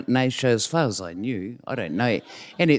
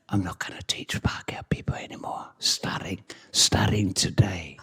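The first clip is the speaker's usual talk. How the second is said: conspiratorial whisper, slowing at the end; really quiet; 7 semitones lower, 6 dB quieter than usual. Retold or imitated. Colleague repeats his refusal to his co-teacher, whispering quietly. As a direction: whisper the refusal, hushed and low. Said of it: "really quietly he said".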